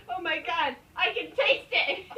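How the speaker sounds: high, loud laughing scream. Retold or imitated. Rob gagging on the smell, laughing scream. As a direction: scream it through helpless laughter. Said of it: "he screamed".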